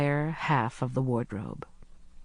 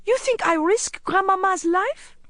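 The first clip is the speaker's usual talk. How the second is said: put-on very high, desperate boy's protest; distressed child begging to be believed; gasped pleading; 3 dB louder than usual. A narrator's reading. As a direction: plead frantically, pitched way up. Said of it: "he gasped".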